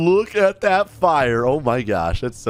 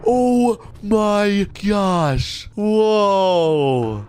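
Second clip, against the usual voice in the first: higher pitch, stretching out the amazement; awed excitement; 5 semitones higher, slower than usual. Real, not acted. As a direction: marvel at a long-awaited reveal. Awed at seeing the jet.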